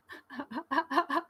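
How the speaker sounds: high, helpless chuckling; real fit of high giggles; laughing. Real, not acted. Streamer cracking up at the live chat, laughing.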